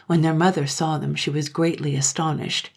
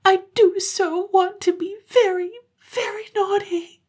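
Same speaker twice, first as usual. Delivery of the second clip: put-on loud, very high child wail; acted childish bawling; bitter sobbing; 4 dB louder than usual. A narrator's reading. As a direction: sob bitterly like a little girl. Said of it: "sobbed more and more bitterly".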